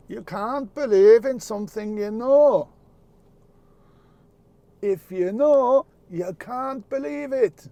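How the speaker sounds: loud, high, self-admittedly bad Jamaican patois; hammed-up Rasta wisdom in a shaky Jamaican accent; a wise Rasta's saying in a mock Jamaican voice; attempted Jamaican accent. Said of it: "I'm not good with Jamaican patois".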